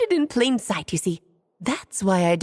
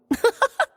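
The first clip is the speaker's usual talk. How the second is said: light laugh; 11 semitones higher than usual. Acted. Alice laughs at her plan.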